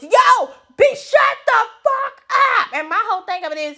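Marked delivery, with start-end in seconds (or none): 0.0-2.7 much higher, slow put-on yell at the crowd; mock yell. Nat yelling at the audience to shut up, mock yell. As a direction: bark it at the audience. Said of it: "Nat start yelling at the damn audience".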